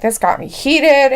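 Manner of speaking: mock heated. Reading a fan's angry chat comment, hammed up. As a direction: play up the viewer's anger. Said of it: "Payne's like".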